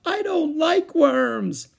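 very high, slow, loud wail; sobbing wail. A narrator's reading. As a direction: bawl it like an upset little girl. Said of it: "she sobbed".

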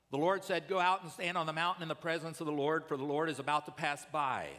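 loud, fast, commanding proclamation; ringing voice of the Lord; booming preaching. Acted. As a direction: call it out like God's command. Preaching at full force.

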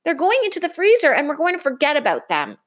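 fast, high cheeky cub; quick taunting echo of Mama; eager crying out. Acted. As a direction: rattle it off smugly. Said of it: "cried Brother".

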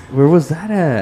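ordinary delivery

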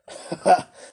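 short, loud mock laugh; a brief laugh put on for the character; acted laugh. A narrator's reading. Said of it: "laughed Tackleton".

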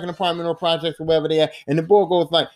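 ordinary talk

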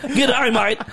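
high mock Australian greeting; put-on Aussie hello; Australian accent. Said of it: "We can do all the accents".

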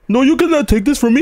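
put-on high, comic Indian accent; mock Indian accent. Friend playing along with the joke, high mock Indian accent. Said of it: "sounds like mad Indian".

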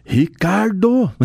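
high, slow, stretched out name call; scolding mother's call. Retold or imitated. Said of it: "o tom de voz que é usado em cima do teu nome". (mãe chamando o filho em tom de bronca)

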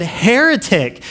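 high, slow hammed-up shout of Heretic; a playful mock scream of heretic; mock outraged cry. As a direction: cry it out as a shocked zealot. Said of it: "and ran away screaming".

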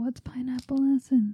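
whispered, puzzled question; half-whispered confusion over the drink. Real, not acted. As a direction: breathe it like a baffled aside. Puzzled aside about the drink.

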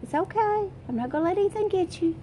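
put-on high, soothing mother's voice comforting a hurt child. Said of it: "and Mama said".

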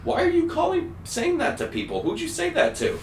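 put-on very high, fretting old lady; shocked grandma. Grandma scolding her grandson on the phone, high and alarmed. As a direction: squeal like a worried grandmother. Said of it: "And she's like".

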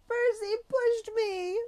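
high, slow, shrill-free scream of accusation. Acted. Girl in fountain screams at teacher, a high slow scream. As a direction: shriek it out, drawn long.

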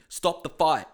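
replaying his furious TV scream, ending in a wordless howl. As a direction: yell at the referee through the TV. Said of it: "screaming at this T V".

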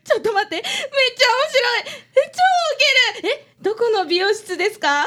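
high, laughing teasing. Acted. Girl laughing at the detective's hairstyle. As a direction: giggle through the mockery.

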